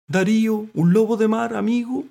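high, loud character voice; mollusc continuing. A narrator's reading. Mollusc naming a sea lion friend, high and loud. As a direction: carry on in a piping register. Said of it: "continuó Mitilo".

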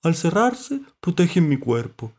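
high, loud, stretched character voice; friendly mollusc. A narrator's reading. Mollusc describing its shell to visitors, high and loud. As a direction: explain from under water, high-pitched.

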